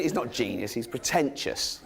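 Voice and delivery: ordinary talk, nothing marked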